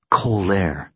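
plain reading voice

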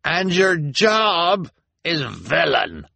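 slow, high gloating call; triumphant. A narrator's reading. Mister Lesko gloating at the prisoner, slow and triumphant. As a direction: savour the gotcha. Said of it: "called out triumphantly".

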